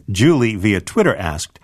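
usual speaking voice

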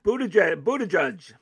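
Buttigieg said as "BOO-tuh-judge"; offering a pronunciation. Real, not acted. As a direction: end Buttigieg like the word judge. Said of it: "I've seen different pronunciations".

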